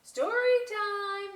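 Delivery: high, loud, slow call. Acted. Miss C calling the bats to story time, loud. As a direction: call the class over. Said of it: "Miss C called".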